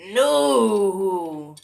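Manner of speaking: long, loud drawn-out "no"; emphatic denial. Acted. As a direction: wail a stretched "nooo". Emphatic contradiction of wrong assumption.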